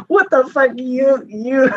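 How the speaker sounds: high, startled disbelief; shocked outburst. Real, not acted. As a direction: caught off guard, pitch jumps way up. Co-host reacts to being told off.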